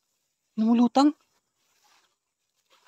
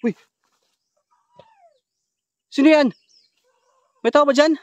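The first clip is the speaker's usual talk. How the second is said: loud, raised challenge into the dark; calling out; 11 semitones higher, 6 dB louder than usual. Real, not acted. Vlogger calls to an unseen stranger, calling out. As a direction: call out higher and louder to whoever is there.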